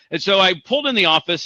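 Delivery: ordinary delivery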